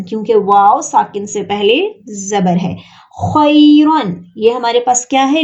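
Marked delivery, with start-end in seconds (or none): 3.2-4.2 खैरन very slowly, louder, खै glided; slow leen. Shown as a demonstration. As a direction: stretch खै half an alif. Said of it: "लीन". (खैरन धीरे, खै लीन)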